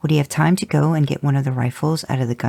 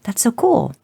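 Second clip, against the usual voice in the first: delighted; 11 semitones higher than usual. Acted. Jared delighted by the girl.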